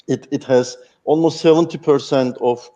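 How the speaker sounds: usual speaking voice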